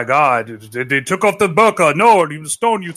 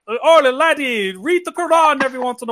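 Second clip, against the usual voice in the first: mock Irish accent; 6 semitones higher than usual. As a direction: play a cheesy Irish priest, in a mock Irish accent. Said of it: "however the fucking Irish sound".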